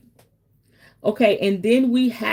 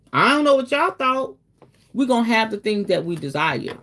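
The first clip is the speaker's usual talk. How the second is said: normal talking voice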